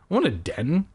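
ordinary talk

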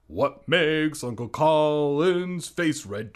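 slow and loud teasing question; loud slow riddle. Acted. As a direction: belt out the riddle slowly.

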